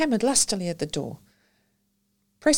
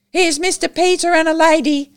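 put-on high, loud child's announcement; loudly; 11 semitones higher, 10 dB louder than usual. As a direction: call it out over the room. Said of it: "said loudly".

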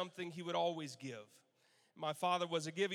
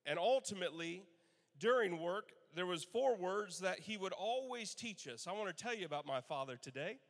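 ordinary talk

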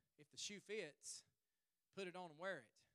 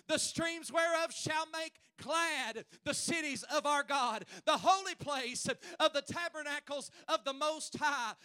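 high, loud, drawn-out preacher's cry; impassioned pulpit shout; fervent preaching; 8 semitones higher, 12 dB louder, slower than usual. Acted. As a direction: preach it at full force, stretching the words. Preaches at full force.

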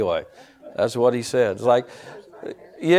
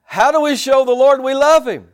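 high, loud preacher's call; punchy, emphatic pulpit exhortation; full-force preaching; 11 semitones higher, 7 dB louder, slower than usual. Acted. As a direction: drive each phrase home to the congregation.

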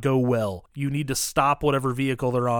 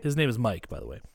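ordinary talk, nothing marked

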